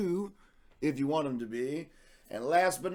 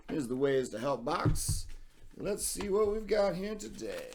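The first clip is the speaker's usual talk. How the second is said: ordinary talk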